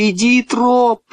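high, insistent call; calling command. A narrator's reading. Boy ordering his reluctant dog into water, insistent call.

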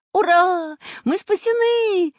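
high, loud cheer; high-pitched, jubilant yell; joyful shout. A narrator's reading. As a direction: shout out the rescue in triumph. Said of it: "закричал Чижик".

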